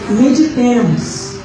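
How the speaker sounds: ordinary talk, nothing marked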